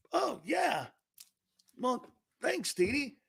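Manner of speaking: high, soft, drawn-in reply; mock bashful. Dad thanking his daughter for praise, small bashful voice. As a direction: act flattered in a small high voice.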